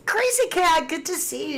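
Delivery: high, bright hello to a friend in chat; excited greeting. Real, not acted. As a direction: greet them like you're thrilled they showed up. Greeting a returning chat viewer.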